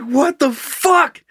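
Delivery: high, drawn-out incredulous reaction; slow, high-pitched "can you believe this" outburst; baffled disbelief. Retold or imitated. Incredulous reaction retold in story.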